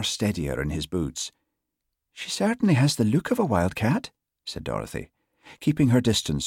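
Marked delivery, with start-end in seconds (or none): 2.1-4.1 high, lilting island woman; soft Western Isles lilt. A narrator's reading. Constable keeping distance from cat, soft Western Isles lilt. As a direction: observe it warily in a Hebridean lilt. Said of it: "in a soft voice delicately laced with an endearing lilt".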